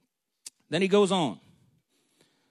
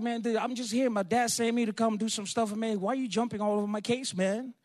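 higher, aggrieved mock protest; protesting younger brother; 4 semitones higher than usual. David complaining to his oldest brother, aggrieved protest. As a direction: complain in a raised pitch. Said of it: "I mean, you know, he's like".

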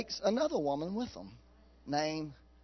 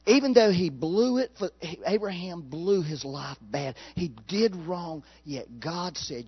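ordinary talk

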